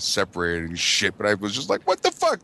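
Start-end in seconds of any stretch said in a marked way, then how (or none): 1.9-2.4 very high mock disbelief; retold indignant protest. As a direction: pitch it way up in baffled outrage. Retold protest after fight.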